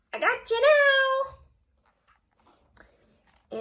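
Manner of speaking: high, playful alien villain; a cartoon alien pouncing on its enemy; gloating alien. Aliens in battle drawing.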